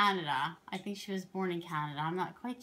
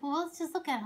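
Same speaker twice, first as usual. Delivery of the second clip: higher mock husband, lightly voiced; imitating her husband coaxing, a bit higher; 8 semitones higher, faster than usual. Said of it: "and he's like".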